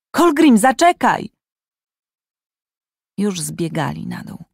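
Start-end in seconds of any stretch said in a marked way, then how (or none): 0.1-1.3 high, loud call after him. Acted. Tarjaj calls after fleeing Kolgrim, loud urgent call. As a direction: shout to stop the boy. Calling after running boy.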